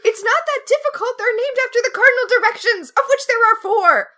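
high, loud ranting over a trivial mistake; exasperated outburst. Real, not acted. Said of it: "I'm so mad at that movie".

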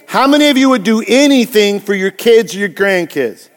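normal talking voice

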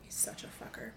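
hushed curse; whispered insult. Real, not acted. Cursing Harvey Weinstein, whispered. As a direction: spit it out under the breath.

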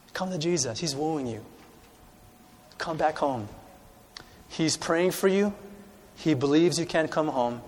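soft, pleading invitation; soft altar call. Acted. Preacher calling listeners to Jesus, soft and tender. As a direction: invite them home gently.

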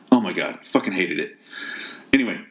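ordinary talk

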